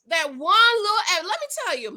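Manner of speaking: ordinary talk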